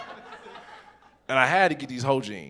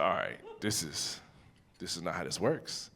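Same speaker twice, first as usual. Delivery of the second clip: quiet exasperated muttering; 8 semitones lower, 10 dB quieter than usual. Retold or imitated. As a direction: grumble it under your breath. Talking to himself, stuck stove.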